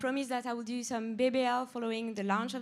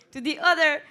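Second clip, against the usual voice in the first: louder, teasing jab at the rest; playful loud scold; 5 semitones higher, 10 dB louder than usual. Real, not acted. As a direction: call out the slackers with mock sternness. Scolding those without objectives.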